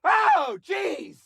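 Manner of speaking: high startled yelp; startled shout. Acted. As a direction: jump at the sight. Shocked by cough.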